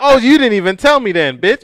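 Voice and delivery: high, loud teasing outburst; mock indignation. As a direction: cut in with playful outrage. Co-host interrupts with mock complaint.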